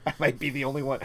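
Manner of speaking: ordinary talk, nothing marked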